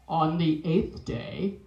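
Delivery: low, slow, booming God voice. Pretend. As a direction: intone it slowly like God himself. God speaks in dream.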